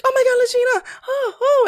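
high, gushing fake-nice squeal; fake gushing friend. Mocking a fake-nice person greeting her, high and gushing. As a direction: overdo the sugary greeting.

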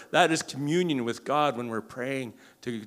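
ordinary delivery

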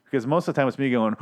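ordinary delivery

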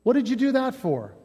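a hint of a wronged man asking why; Jesus confronting his striker. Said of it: "He calls out his striker and he says".

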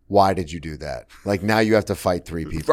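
low, soft retold thought; inner self-reproach. Comedian's inner voice after provoking three men, quiet reproach. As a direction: quietly scold yourself in disbelief.

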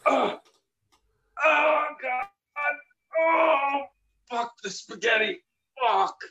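hammed-up dying anguish. Reprising the spaghetti heart attack for a friend, hammed-up wailing. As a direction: ham up a death scene, wailing over the spaghetti. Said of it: "I'm so glad you took it as long as you took it".

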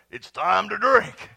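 drawn-out shouted slogan; loud, slow proclamation. Acted. Pastor pictures signs all over house, loud proclamation. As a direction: belt out the sign slowly. Same sign, now called out.

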